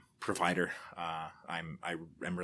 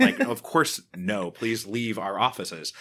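scornful dismissal; 3 semitones higher, 7 dB louder, slower than usual. Pretend. Imagined executive rejecting pitch.

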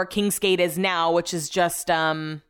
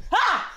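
sudden high shriek of fright; a real jump-scare scream; startled scream; 14 semitones higher than usual. Real, not acted. Startled by her husband creeping up behind her, a sudden shriek.

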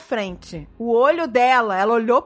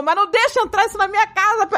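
high-pitched retold begging; desperate plea; 8 semitones higher than usual. Woman retelling her prayer to God at home, desperately pleading.

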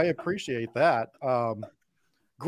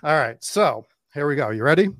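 ordinary talk

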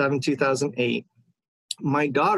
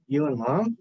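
questioning rise, lightly acted; mildly sheepish retold question; tentative answer. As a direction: answer as if unsure it is right. Retold timid answer to father.

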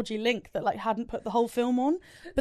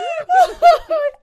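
high loud laugh; 13 dB louder than usual. Real, not acted. Laughing at own accent.

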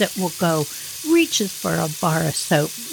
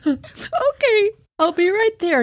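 a much higher, sing-song pretend cheerfulness; mom's fake-calm reply; 12 semitones higher than usual. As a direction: mom covering up, voiced high and bright-sounding. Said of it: "My mom's like".

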